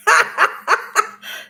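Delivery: high bursts of laughter; laughing. Real, not acted. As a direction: break into a real, high-pitched laugh. Laughs while retelling the remark.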